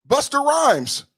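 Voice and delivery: slow, sing-song Jamaican accent. Shown as a demonstration. Showing viewers the Jamaican accent he calls Irish, slow Jamaican accent. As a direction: stretch the name out in a Jamaican accent. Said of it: "That accent is an Irish accent".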